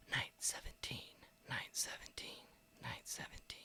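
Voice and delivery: fading whisper. Acted.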